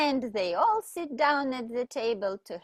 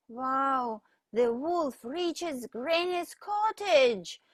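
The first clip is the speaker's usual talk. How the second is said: ordinary delivery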